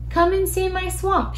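high, sing-out invitation; cheerful storybook call; welcoming invitation. A narrator's reading. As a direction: call everyone in, pitched well up. Kylie invites monsters in.

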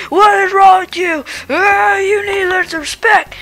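cranky, hard-of-hearing grandpa voice; a deaf old dad barking back; grumpy old man. Acted.

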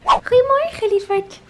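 high, loud, bright morning call; high sing-song greeting. Real, not acted. Greeting her child in the morning.

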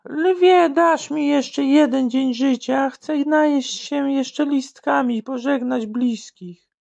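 high slow begging giraffe. Acted. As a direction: plead slowly in a high animal voice. Giraffe pleads with lion.

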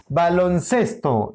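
slow baloncesto with the weight on ces; stress on CES. Shown as a demonstration. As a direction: draw out baloncesto and lean on CES. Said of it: "pero ces sí". (baloncesto despacio, con acento en ces)

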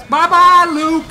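gloating taunt. Real, not acted. Gamer taunting defeated opponent Luke, gleeful sing-song. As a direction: wave the enemy off the map in triumph.

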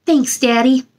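ordinary delivery, nothing marked

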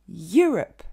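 Europe à l'anglaise, YOU fort puis rup très court; accent sur YOU. Shown as a demonstration. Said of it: "l'accent tonique est sur le you". (Europe en anglais, accent tonique initial)

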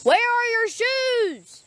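high, slow drawn-out call; incredulous call. Real, not acted. Kid calling to barefoot friend outside, slow high incredulous call. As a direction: stretch it out in disbelief.

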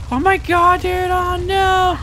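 high-pitched gamer panic; alarmed outcry. Real, not acted. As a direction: cry out in dismay, stretching the words. Alarm at mishap in game.

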